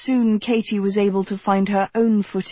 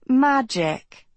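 "Magic" echoed higher, mocking and dry; sarcastic; 4 semitones higher than usual. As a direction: throw "Magic" back with a scoff. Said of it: "her voice laced with sarcasm".

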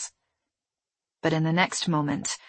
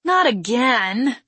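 loud, high, drawn-out groan of exasperation; slow, loud exasperated complaint; 6 semitones higher, 6 dB louder, slower than usual. A narrator's reading. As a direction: groan it out, fed up. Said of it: "he groaned".

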